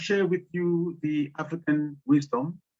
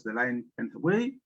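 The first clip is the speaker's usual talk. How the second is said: ordinary delivery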